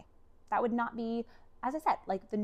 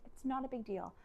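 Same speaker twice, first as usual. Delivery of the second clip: very soft, higher, soothing voice; merciful reassurance; 4 semitones higher, 8 dB quieter than usual. Retold or imitated. Husband forgiving wife for the broken door, soft reassurance. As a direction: let it go gently and quietly. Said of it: "just mercy".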